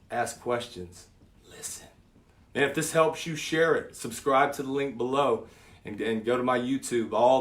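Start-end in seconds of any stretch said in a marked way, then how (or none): 1.5-1.9 "Listen." softer, almost breathed; hushed repeat. Shown as a demonstration. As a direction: drop to a hush for the last word. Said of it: "And listen".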